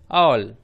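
ऑल as 'aw' joined to l; loud, held ऑल; blended word demo. Shown as a demonstration. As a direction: blend ऑल into one syllable. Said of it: "ए का उच्चारण यहाँ पर हो रहा है औ".

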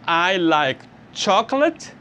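slow, careful English model sentence; slow model answer. Shown as a demonstration. Teacher modelling the full answer slowly. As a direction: drag it out clearly for learners. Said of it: "tem que ter um complemento".

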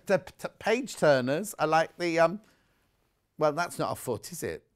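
usual speaking voice

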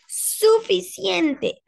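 slow, high, firm scolding; stern mother. Acted. Mother scolding Ada, slow and stern. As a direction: drag the word out in anger. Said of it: "Su madre dijo".

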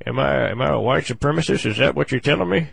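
normal talking voice

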